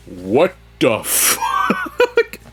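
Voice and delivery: high, slow, laughing "what the fuck"; amazed laughing outburst; laughing disbelief. Retold or imitated. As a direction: crack up in disbelief at the money. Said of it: "That shit made me laugh".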